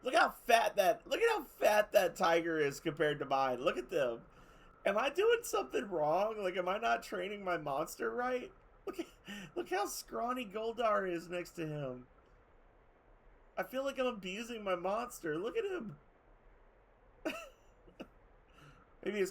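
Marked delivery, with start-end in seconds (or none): 0.0-4.2 loud, high amazed exclamation; louder and higher, stunned. Real, not acted. Amazed at fat tiger. 4.8-16.0 mock worry. Real, not acted. Mock-worried about monster.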